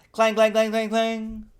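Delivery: high ringing clang clang like a fire bell; high fire alarm bell clangs. A narrator's reading. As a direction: ring it out high. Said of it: "the fire alarm went off".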